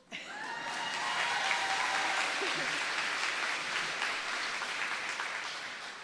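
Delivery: high breathy nervous laugh. Real, not acted. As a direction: giggle from stage nerves. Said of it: "I'm nervous".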